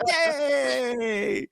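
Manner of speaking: high, gleeful yay; cheering at the screen; mock cheer. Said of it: "were like".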